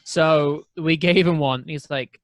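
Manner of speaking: ordinary delivery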